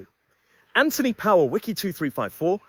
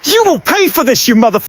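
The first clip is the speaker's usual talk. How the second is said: furious threatening roar; enraged boss roar; 2 semitones higher, 10 dB louder than usual. Acted. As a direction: scream the threat with rage.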